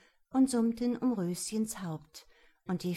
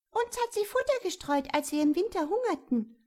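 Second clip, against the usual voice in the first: much higher, quick little bird voice; chirping sparrows; 11 semitones higher, 4 dB louder, faster than usual. A narrator's reading. Sparrows tell the king of her kindness, high and chirpy. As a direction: twitter it out like a grateful sparrow. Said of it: "zwitscherten die Sperlinge".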